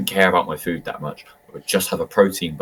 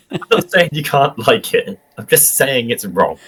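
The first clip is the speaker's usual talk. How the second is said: higher-pitched, half-laughing insistence; retold ranting retort; 7 semitones higher, 5 dB louder than usual. Defending a burger rant to a co-worker, mock-indignant. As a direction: argue the point indignantly through a laugh. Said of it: "Jake shouted at me about".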